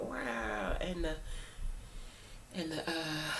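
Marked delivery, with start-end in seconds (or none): 0.0-0.8 a low, little lion cub growl; weak cub growl. Retold or imitated. Speaker mimics young Simba's weak growl, low. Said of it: "like, growling".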